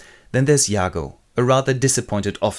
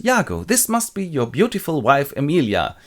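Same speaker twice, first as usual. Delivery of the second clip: higher, courteous lilt; politely charming, pitched up; very polite greeting; 7 semitones higher than usual. Acted. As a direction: greet her with mock gallantry. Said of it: "very politely greets".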